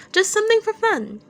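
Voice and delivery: high, loud wheedling; coaxing Dazai voice. Acted. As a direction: talk him into it playfully. Dazai coaxes Chuya to sing.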